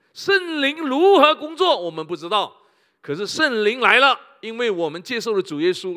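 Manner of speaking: loud, high preaching with rising fervor; fervent pulpit preaching; full-force preaching. Acted. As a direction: preach it out to the whole hall.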